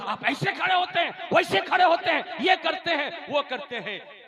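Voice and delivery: loud, high imitation of a telling-off; retold scolding. Imam reprimands a worshipper before everyone, retold scolding. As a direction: rattle off a mock public reprimand. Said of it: "मुक्तदी को डांट दिया था".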